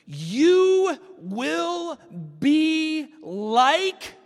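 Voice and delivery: very high, loud stretched serpent call. Acted. Preacher voicing the serpent's promise to Eve, very high and loud. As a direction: sing it out like a tempter dangling a prize.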